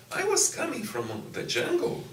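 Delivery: high, laughing-edged exclamation about culture shock; animated exclamation. Real, not acted. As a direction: toss off the joke with a high burst. Telling of arrival shock, listener laughs.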